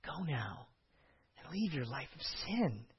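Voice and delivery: gentle Jesus. Shown as a demonstration. Preacher voicing Jesus the right way, soft and kind. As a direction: speak it kindly, almost hushed. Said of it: "my tone of voice is intentional".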